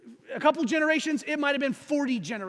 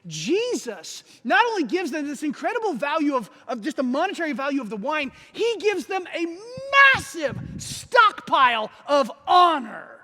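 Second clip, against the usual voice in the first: emphatic preaching; 4 semitones higher, 6 dB louder than usual. Real, not acted. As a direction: preach it big, stretching the climax. Preaches with building force.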